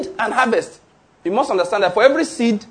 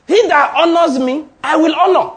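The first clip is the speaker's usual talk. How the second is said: louder and higher proclamation; declaring God's promise to the congregation, loud and high; proclaimed scripture; 6 semitones higher, 4 dB louder than usual. Acted. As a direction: ring out the verse like a preacher. Preacher quoting scripture with force.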